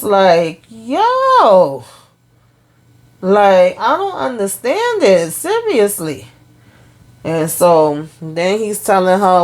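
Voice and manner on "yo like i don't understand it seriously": high, drawn-out exasperated exclamation; utter disbelief. Real, not acted. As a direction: let your pitch soar in bafflement. Teller reacts in utter disbelief.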